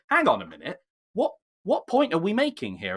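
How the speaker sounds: exasperated, rising high on the question; baffled objection. Retold or imitated. Podcaster voicing a viewer challenging the film's message, exasperated. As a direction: break in with mock bafflement, pitch climbing.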